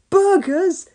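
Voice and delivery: high, rising excited yell; greedy boy yelling. A narrator's reading. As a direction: shout at the news of burgers. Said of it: "yelled Norman".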